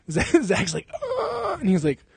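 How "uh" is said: very high, drawn-out whimper; mock pained moan. Zach groans while Kimbo beats him, mock pained. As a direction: moan feebly like a beaten victim. Beaten Zach's feeble moan, acted.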